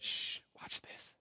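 hushed, drawn-out whisper; konspiracyjny szept. Retold or imitated. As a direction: whisper it like a prankster shushing the room. Uncle hushing before a prank.

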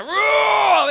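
loud high "whoa" holler; playful scream. Shown as a demonstration. Preacher testing the echo of the room, screaming. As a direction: let a big whoop ring round the room. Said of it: "I love screaming in this room".

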